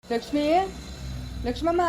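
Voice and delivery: high, rising call of her name. Acted. Father-in-law calls Lakshmamma from a distance, high call. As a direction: call her from across the yard.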